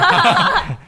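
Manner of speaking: high, loud surprised laugh; startled loud laugh; burst of laughter. Real, not acted. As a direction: laugh out in shock. Shocked host laughs at name dropped.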